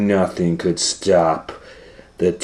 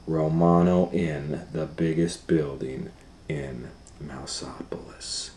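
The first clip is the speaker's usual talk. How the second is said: slow, softer and lower, down to a whisper; fading to a whisper; 7 semitones lower, 10 dB quieter than usual. A narrator's reading. Narrator hushing the story as the cat climbs. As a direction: build suspense by dropping to a hush.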